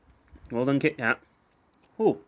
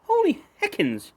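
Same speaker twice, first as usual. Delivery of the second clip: amazed. Real, not acted. Amazed by the snack flavour.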